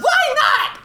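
put-on high shrieking kid yell; little girl yelling back. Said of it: "Louise yelled back".

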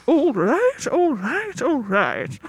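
a rising goofy take on the catchphrase; another bad McConaughey try. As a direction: lineup suspect botching the catchphrase. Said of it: "then you get like".

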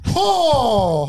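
loud, high drawn-out "oh"; re-enacted cry of agony; groan of pain. Patient retells nerves being pulled, groan of pain.

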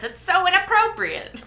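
high, prim mock outrage; mock scandalized. Co-host mimicking Serena's prim outrage. Mocking Serena's age-gap complaint.

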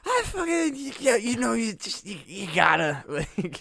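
put-on slurred, squeaky high drunk ramble. Shown as a demonstration. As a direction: play yourself blackout drunk. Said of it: "drunk impersonation of myself".

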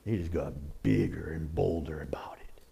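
hushed dramatic whisper; preacher whispering to the congregation. Real, not acted. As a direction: lean in and whisper it. Dramatic aside in sermon.

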